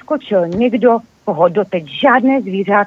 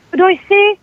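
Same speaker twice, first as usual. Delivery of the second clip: high startled cry; 11 semitones higher, 3 dB louder than usual. A narrator's reading. Doe crying out at the robber, high and loud. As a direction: call out at the stranger in alarm. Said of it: "vykřikla srnka".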